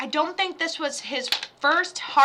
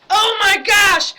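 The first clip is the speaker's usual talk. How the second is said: loud, high, drawn-out shock; startled exclamation; 8 semitones higher, 10 dB louder, slower than usual. Real, not acted. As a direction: cry out slowly in real surprise. Startled at being told she sounds country.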